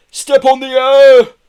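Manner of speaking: very loud, high, stretched yell. A narrator's reading. Mambo telling the driver to speed up, yelling. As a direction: holler it urgently. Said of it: "yelled Mambo".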